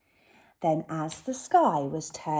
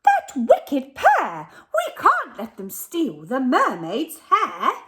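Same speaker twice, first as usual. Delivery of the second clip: fish declaring; 6 semitones higher, 6 dB louder than usual. Acted. The fish warns the sea creatures, loud and high. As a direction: sound the alarm like an outraged fish. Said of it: "And the fish declared".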